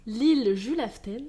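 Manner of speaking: « Julaften » dit lentement, à la norvégienne; prononciation norvégienne. Shown as a demonstration. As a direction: articuler « L'île Julaften » en détachant chaque syllabe. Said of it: "je le répète encore une fois pour mon accent". (prononcer « Julaften » lentement, avec un accent norvégien)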